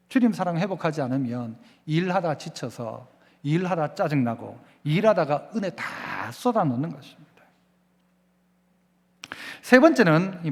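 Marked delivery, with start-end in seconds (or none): none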